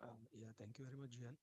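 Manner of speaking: faint, far from the mic; barely audible. Real, not acted. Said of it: "you are not audible".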